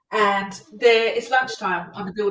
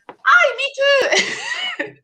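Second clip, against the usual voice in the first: high, squeaky mock Irish accent. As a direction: pop the voice up high into a jokey Irish brogue. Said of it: "I tried to do the accents".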